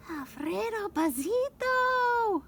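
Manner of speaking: ordinary delivery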